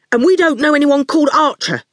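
snapping. A narrator's reading. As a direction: snap back at the intruder. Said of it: "Howard snapped".

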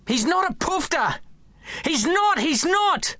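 put-on boy screaming at his nan in defence of dad; child's scream. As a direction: scream it at her with all he has. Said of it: "I scream at the hag".

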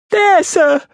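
very high, loud frightened squeak; timid child. A narrator's reading. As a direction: point it out nervously in a small boy's voice. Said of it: "I timidly explained".

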